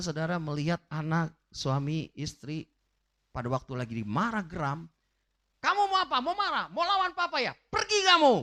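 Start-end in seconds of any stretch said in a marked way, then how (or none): 5.6-8.3 mock furious father, higher and building to a shout; angry parent. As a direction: snap at the child, then yell him out. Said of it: "jangan digituin anak itu". (ayah yang membentak anaknya dengan marah)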